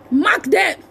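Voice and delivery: normal talking voice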